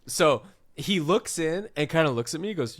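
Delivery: ordinary delivery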